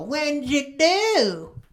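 very slow, high grandma jab; a hint of a poking grandma. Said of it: "and go".

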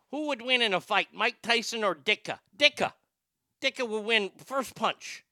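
high-pitched Chicago sports fan; hammed-up Chicago accent. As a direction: argue it like a Chicago superfan. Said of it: "does a great Chicago accent".

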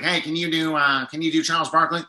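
lightly imitated radio comedian buddy; Joe Robinson. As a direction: do a slight take on your buddy's voice. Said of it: "That's how he sounds, by the way".

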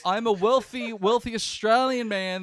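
a hammed-up wealthy Australian boasting; pompous rich man. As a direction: play the generous god showing off. You think you're being this generous god.

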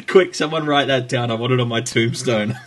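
laughing through the words; amused. Real, not acted. Host reacts to co-host's backhanded compliment, laughing as he talks. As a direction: crack up while demanding it be recorded.

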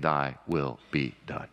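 hushed, devoted surrender; reverent. Shown as a demonstration. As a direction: pray it straight to your Savior. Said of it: "looking full in the face of your Savior".

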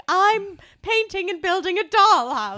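excited quote. Retold or imitated. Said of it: "and I was like".

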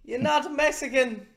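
high-pitched comic parent voice; mock scolding parent. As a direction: scold your son in a high put-on voice. Plays an embarrassed cartoonish parent.

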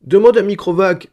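put-on high, loud tearful little girl; child sobbing. A narrator's reading. Upset daughter begging papa to ask Microvac, sobbing. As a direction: sob it out in a small child's voice. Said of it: "sanglota Gérodette un".

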